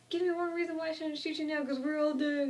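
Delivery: high and fast, furious; angry threat. Retold or imitated. Andrea threatening the newcomer, high, fast and furious.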